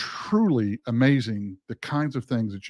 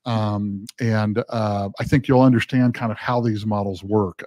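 normal talking voice

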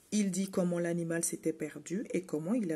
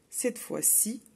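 usual speaking voice

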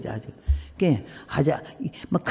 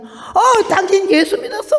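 much higher, laughing disbelief; a nun caught completely off guard; stunned surprise; 10 semitones higher, 3 dB louder, slower than usual. Retold or imitated. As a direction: gasp it out, half laughing. Nun stunned by her answer.

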